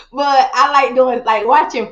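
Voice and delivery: laughing. Real, not acted. Laughing to the host about her thrift budget, laughing. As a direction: let the laugh spill into the words.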